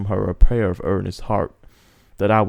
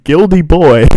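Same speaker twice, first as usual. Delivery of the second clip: mock announcement; 8 semitones higher, 13 dB louder than usual. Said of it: "It's like".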